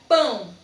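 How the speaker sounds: PÃO said as one loud, falling syllable; intoned in one beat. Shown as a demonstration. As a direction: punch out PÃO in a single beat. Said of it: "Observe ao entonar a palavra pão".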